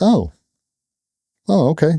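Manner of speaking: high-pitched imitation of taken-aback parents; parents' polite realization. Parents learning he is Lola's dad, high mimicked realization.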